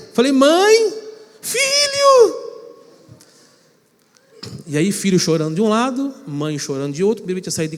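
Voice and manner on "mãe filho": very high, strained tearful cry; high pleading sob of a teenage son calling his mother. Retold or imitated. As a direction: choke it out like a homesick boy. Son crying on phone.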